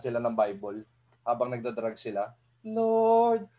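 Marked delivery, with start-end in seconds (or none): 2.7-3.5 higher, hammed-up solemn prayer voice; mock pious prayer. Friend piously praying over his drug-using buddies, hammed-up. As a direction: pray over them like a mock saint.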